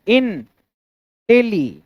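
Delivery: "इन", pause, then "टेली", as separate pieces; syllable demonstration. Shown as a demonstration. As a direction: sound out "इन" and "टेली" apart. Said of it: "इंटेलिजेंट में देखिए कितना है". ("इन" और "टेली" को अलग-अलग टुकड़ों में बोलें)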